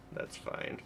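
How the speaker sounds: That's fine. whispered with no voice. Real, not acted. Streamer reassuring chat in a whisper.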